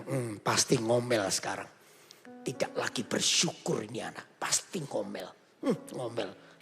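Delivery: low, soft suspicious murmur; father's hushed suspicion; quiet musing. Acted. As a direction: think it aloud to yourself. Father muses second son will grumble.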